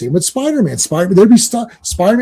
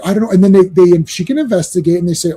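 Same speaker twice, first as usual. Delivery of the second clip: ordinary talk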